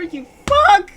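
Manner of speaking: high, explosive shout; furious cursing. Real, not acted. Player cursing the game for the tearjerker, high loud outburst. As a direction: burst out cursing at the top of the voice.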